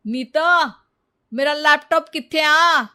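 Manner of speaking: loud call across the house. Acted. Husband calling Neeta to find his laptop, loud. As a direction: shout for her from another room.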